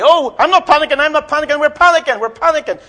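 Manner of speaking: high, loud, flustered denial of panicking; mock panic. Preacher mimics the congregation's own panic, high and loud. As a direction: ham up someone panicking while denying it. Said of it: "And that's what we say".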